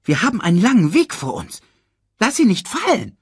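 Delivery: high, loud meerkat orders; bossy meerkat, fussing. Acted. Meerkat to warthog carrying the cub, high bossy voice. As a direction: fuss at him in a high voice.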